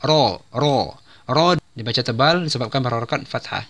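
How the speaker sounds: ordinary delivery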